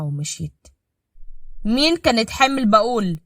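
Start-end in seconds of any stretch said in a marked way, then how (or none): 1.6-3.2 louder tense husband; stern demand. Acted. Husband demanding who was pregnant. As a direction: push for an answer.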